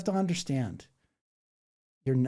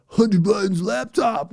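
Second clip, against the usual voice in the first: mock gotcha voice; 7 semitones higher, 8 dB louder than usual. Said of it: "And then they go".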